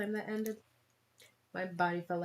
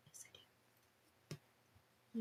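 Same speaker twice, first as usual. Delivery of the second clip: whisper; 19 dB quieter, much slower than usual. Pretend. Answering own question in whisper.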